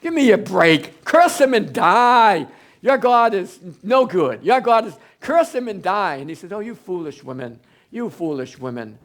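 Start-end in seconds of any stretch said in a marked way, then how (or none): 0.0-6.2 loud, slow, scornful command; wife's contempt. Acted. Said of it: "his wife said". 6.5-9.0 soft rebuke. Acted. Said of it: "like that".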